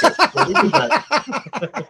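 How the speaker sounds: laughing. Real, not acted. Laughing at the lore.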